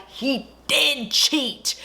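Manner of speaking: high, drawn-out outraged realisation; slow, high-pitched gossipy disbelief; mock scandalised. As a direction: stretch each word in acted shock. Acts viewer's scandalised realisation.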